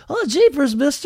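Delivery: put-on high, wide-eyed innocent kid voice; gee-whiz small-town boy, way up high; wholesome 1950s kid. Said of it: "and I was just like".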